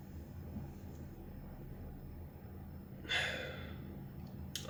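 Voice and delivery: long, quiet exhale; weary sigh. Real, not acted. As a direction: let out a real, soft sigh. Pausing after admitting hopeless feeling.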